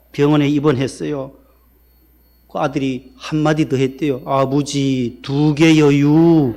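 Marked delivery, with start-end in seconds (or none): 4.3-6.5 Chungcheong dialect, dragged out very slowly and loud; slow Chungcheong drawl. Retold or imitated. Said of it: "늦게 해서 또 맞았대요". (아들이 충청도 사투리로 아주 느리게 늘어지는 말투)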